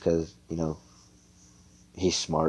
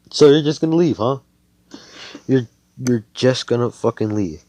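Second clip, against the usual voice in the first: raised, irritated challenge; a little pissed off; 5 semitones higher, 6 dB louder than usual. Acted. Austin confronts the girl who is leaving, pissed off. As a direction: call them out, louder and higher. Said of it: "Austin's just a little pissed off about this".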